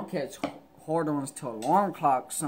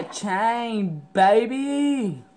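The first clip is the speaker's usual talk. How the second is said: loud, high hype shout; hyped up; 6 semitones higher, 6 dB louder, much slower than usual. Real, not acted. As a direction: show it off with a yell. Showing off the chain.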